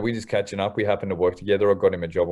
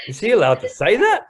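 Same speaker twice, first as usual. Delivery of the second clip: imitating stunned trainees, higher and louder; mock scandalised whisper-turned-gasp of a staff member; higher, louder mock disbelief from the team; shocked trainees; 12 semitones higher, 6 dB louder than usual. Trainees react to his swearing.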